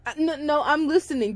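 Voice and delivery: usual speaking voice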